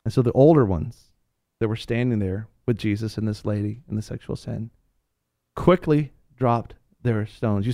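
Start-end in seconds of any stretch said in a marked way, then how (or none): none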